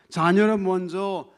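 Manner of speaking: loud, slow, drawn-out mock rebuff; stern rebuff. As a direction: play a stern refusal, stretched out loudly. Acts Jesus rebuffing the woman.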